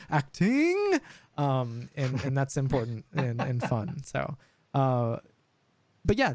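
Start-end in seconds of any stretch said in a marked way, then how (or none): 0.1-1.0 "acting" sung out high and theatrical; hammed-up flourish on "acting"; high dramatic "acting". Shown as a demonstration.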